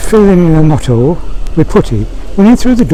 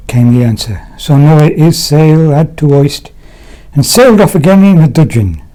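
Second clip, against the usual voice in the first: usual speaking voice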